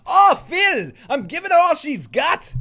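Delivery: high, loud Irish engineer voice; Miles O'Brien impression. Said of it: "That's my Miles Edward O'Brien".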